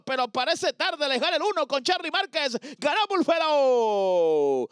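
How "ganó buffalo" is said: drawn-out winner call. Real, not acted. Announces the race winner.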